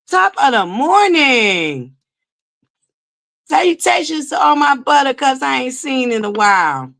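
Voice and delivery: high, drawn-out sing-song hello; theatrical welcome, stretching out each Salutations; showy greeting voice. Pretend. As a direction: greet each fan like a grand host. Greeting viewers by name in a put-on voice.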